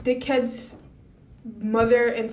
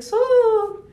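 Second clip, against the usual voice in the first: high, drawn-out "So"; put-on questioning voice; 12 semitones higher, 5 dB louder than usual. Woman confronting a guy about a rumor, put-on high voice. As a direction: raise the pitch and stretch it out loudly. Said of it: "in the voice, I was like".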